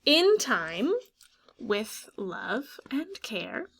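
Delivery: put-on storybook read to children, hushed in places; story reading. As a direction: read it like a bedtime story to a class. Teacher reads picture book aloud.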